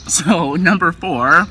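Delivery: number four repeated slowly through a laugh. Real, not acted. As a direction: laugh it out and slow down. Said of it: "You heard me".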